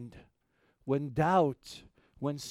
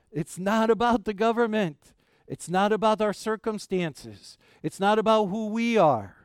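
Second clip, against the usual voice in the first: high, louder preacher's litany; rising preaching; 6 semitones higher, 6 dB louder than usual. Acted. Pastor dismissing government and circumstances, rising preaching. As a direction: stack each line higher.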